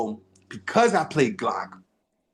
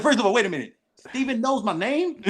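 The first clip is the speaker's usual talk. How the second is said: high-pitched, laughing disbelief; incredulous; 10 semitones higher than usual. Retold or imitated. Actor learning Steven knows his name, incredulous. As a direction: retell being amazed, voice lifted.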